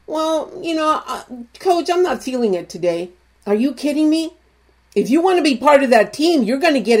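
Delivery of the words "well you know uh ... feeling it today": soft, mock-lazy whine to the coach; whiny excuse. Player excusing himself to the coach, soft mock whine. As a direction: play the player making a weak excuse. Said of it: "you don't sit there and you go".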